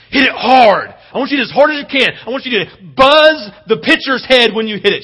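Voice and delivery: calling out encouragement loudly from the stands; loud cheering. Retold or imitated. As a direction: fire her up before she bats. Dad cheering daughter at bat.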